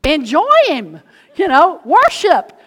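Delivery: loud, high, slow urging; excited encouragement. Retold or imitated. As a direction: cheer him on, drawn out. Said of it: "We're like".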